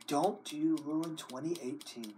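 ordinary talk, nothing marked

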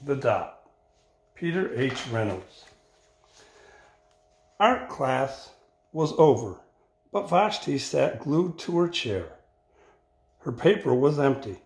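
put-on expressive read-aloud for children; picture-book reading voice; story narration. A narrator's reading. Teacher reads picture book aloud.

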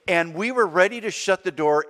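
normal talking voice

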